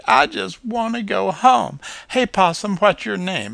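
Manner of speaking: put-on high, loud childlike voice; small and a bit lost; young squirrel. A narrator's reading.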